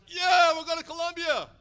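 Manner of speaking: high, loud eager group yell; crowd shout. Retold or imitated. Leaders answering the preacher, loud eager shout. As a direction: shout it back like a fired-up crowd. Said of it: "raised their hand and goes".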